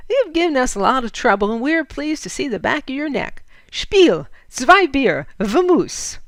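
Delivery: loud, scornful send-off, rising to a shout; rough bandit. Acted. Hondo Bill dismisses Fritz, loudly mocking. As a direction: shout them on their way.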